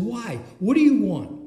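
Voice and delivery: high-pitched, impatient questioning; retold exasperation. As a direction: act out a fed-up reply, punching the last question. Retold exasperated reply on phone.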